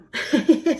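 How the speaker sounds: a short bright laugh at her own joke; quick embarrassed giggle; amused laugh. Real, not acted. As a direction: laugh it off, a little sheepish. Laughs after secret remark.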